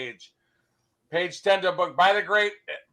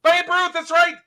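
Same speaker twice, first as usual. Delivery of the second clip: high, loud acted excitement; high-pitched triumphant cry; 12 semitones higher, 6 dB louder than usual. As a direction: jump in loudly as if the answer won. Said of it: "And they go".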